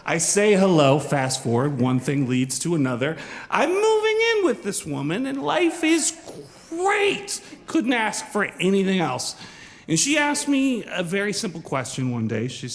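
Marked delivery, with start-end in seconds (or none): 3.5-9.4 giddy. Acted. Giddy recap of moving in.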